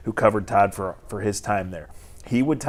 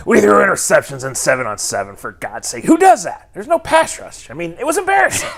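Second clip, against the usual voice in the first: raspy, loud, higher-pitched coach rant; Todd Monken voice; 6 semitones higher, 6 dB louder than usual. Retold or imitated. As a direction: bark it like a disgusted raspy coach. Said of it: "read it for me in your best Todd Monken voice".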